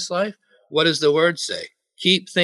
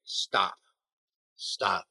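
low, quiet command; hushed stern stop; soft firm stop; 7 semitones lower, 7 dB quieter than usual. Shown as a demonstration. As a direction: gently halt the thought. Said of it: "if you find yourself dwelling".

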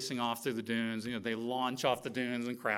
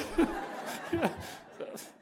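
laughter. Real, not acted. Laughing at the punchline.

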